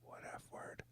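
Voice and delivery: barely audible, voiceless aside; whisper. Real, not acted. Cohost trying to trick her into swearing, whispered. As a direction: whisper it as sly bait.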